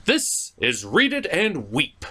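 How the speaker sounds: normal talking voice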